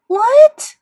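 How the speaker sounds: very high, loud outraged yell. A narrator's reading. Sister yelling at her mother, outraged. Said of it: "Via yelled at Mom".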